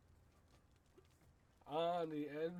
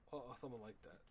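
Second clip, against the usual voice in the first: ordinary delivery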